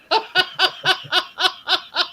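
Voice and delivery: high, rapid peals of laughter; real laugh. Real, not acted. Friend amused by the birthday gaffe, high laughter.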